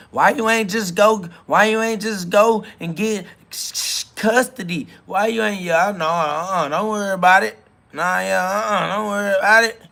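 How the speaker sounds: high mocking voice of the baby mama; mocking imitation. Mocking the baby mama's excuses.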